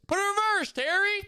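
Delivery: high cartoon quote, held up; Carl Weezer impression. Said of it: "the I can't tie my shoes one".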